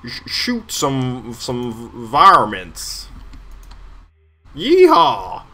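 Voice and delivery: slow drawled country yell, then a loud whoop; hillbilly cowboy voice. Pretend. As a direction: play a rowdy cowboy hollering. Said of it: "I could fit right in".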